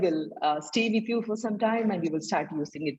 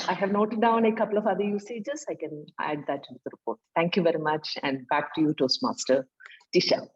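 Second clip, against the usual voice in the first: ordinary talk, nothing marked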